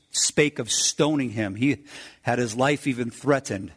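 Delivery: ordinary delivery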